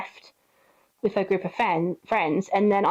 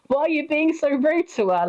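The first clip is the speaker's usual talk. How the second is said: acted-out higher-pitched reproach; indignant; 6 semitones higher than usual. Friend defending the snubbed relative, indignant. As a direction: imitate an appalled friend, voice lifted.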